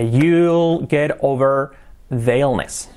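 drawn-out teacher demo; slow English example; slow model phrase. Shown as a demonstration. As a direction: stretch it out for the class. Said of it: "teacher models phrase slowly".

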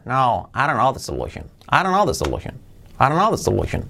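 "I don't know the solution" run together as "aronno the solution"; quick, softened "don't know", words melted into one; native connected speech. Shown as a demonstration. Teacher imitates a native speaker, linked "I don't know the solution". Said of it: "cuando un nativo te diga".

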